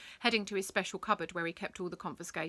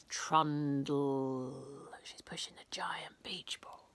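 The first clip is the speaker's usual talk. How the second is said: whispered aside; 5 semitones lower, slower than usual. A narrator's reading.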